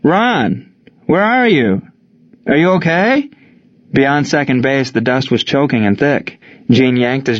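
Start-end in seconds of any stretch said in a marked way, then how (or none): 0.0-3.3 high, worried calling out; searching shout, more pitch than volume; yelled call. A narrator's reading. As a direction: call high across a field for a missing friend. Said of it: "Gene yelled".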